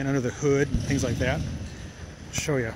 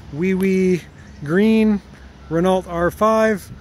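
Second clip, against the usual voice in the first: loud, emphatic wrap-up of the car name; showing off the little French car with a cheerful flourish; excited announcing; 5 semitones higher, 6 dB louder, much slower than usual. Real, not acted. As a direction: proudly present the little green Renault. Excited summing up of the cars.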